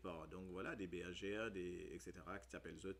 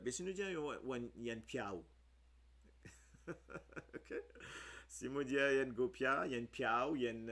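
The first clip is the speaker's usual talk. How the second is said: amused emphasis; 4 semitones higher, 6 dB louder, faster than usual. Real, not acted. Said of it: "joking about a word's sound".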